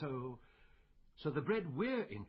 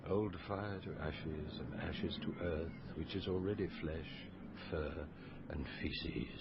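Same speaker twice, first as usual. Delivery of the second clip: solemn recitation; 8 semitones lower than usual. Acted. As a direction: intone it like a funeral rite. Recites a mock liturgy.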